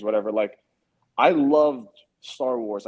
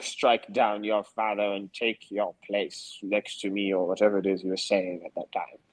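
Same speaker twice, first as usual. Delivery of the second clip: put-on menacing old Sith lord; Emperor Palpatine voice; 5 semitones lower, 4 dB quieter than usual. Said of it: "you can see Sidious saying to Luke".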